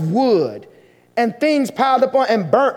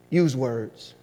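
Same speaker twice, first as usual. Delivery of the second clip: hushed final words; 13 semitones lower, 9 dB quieter than usual. Acted. Closing quote of the sermon.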